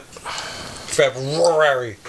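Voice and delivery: February drawn way out on the middle "r"; exaggerated R. Shown as a demonstration. Co-host drives the correction home, exaggerated R. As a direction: say February very slowly, dragging the R. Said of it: "There's an R in there".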